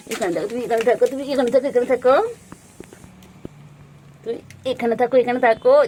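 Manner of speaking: high, loud insistence to stay here; firm repeated command. Real, not acted. Owner ordering the pet to stay put, firm high loud repeats.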